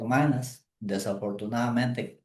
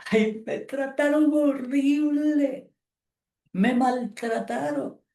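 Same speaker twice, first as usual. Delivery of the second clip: high, slow, hammed-up wail; mock a self-pitying sob story; whiny tearful lament; 12 semitones higher, 3 dB louder, slower than usual. Pastor mimicking a church lady crying over mistreatment. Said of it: "viven llorando, dicen".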